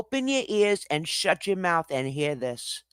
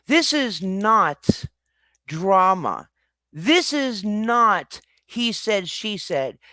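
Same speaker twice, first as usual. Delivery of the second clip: slow emphatic; much slower than usual. Real, not acted. Emphatic rant at chat.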